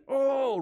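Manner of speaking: high frustrated "oh"; a frustrated groan in front of everybody; exasperated groan. Retold or imitated. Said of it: "I don't know that Jesus went".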